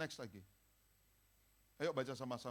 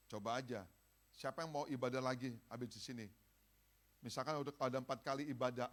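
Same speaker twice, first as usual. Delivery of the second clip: ordinary talk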